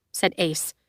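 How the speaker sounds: ordinary delivery, nothing marked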